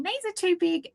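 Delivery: high, mock kid whine; complaining child. Mum quoting her daughter about borrowed clothes, high child voice. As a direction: imitate a child objecting to the fit.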